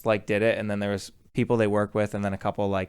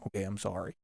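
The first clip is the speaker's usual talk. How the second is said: meek apology; 4 semitones lower, 14 dB quieter than usual. Retold or imitated. Drunk guest backs down to captain.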